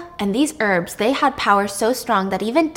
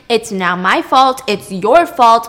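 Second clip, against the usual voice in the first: slow, louder on "It's your fault"; emphatic blame; 5 dB louder, slower than usual. Acted. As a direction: lean hard into the blame, slowly. Glaucus blaming himself, then Circe.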